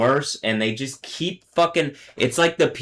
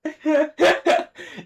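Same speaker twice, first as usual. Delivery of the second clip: high laugh. Real, not acted. Laughing at an accent no longer classy, high-pitched.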